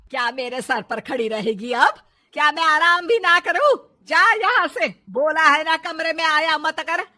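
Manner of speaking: loud, harsh berating; angry scolding. Acted. Mother-in-law chases daughter-in-law from her room, loud berating.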